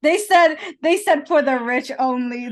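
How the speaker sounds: loud, high mock proclamation; mock announcement. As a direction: declare it grandly, teasing. Mocking Monaco's exclusivity.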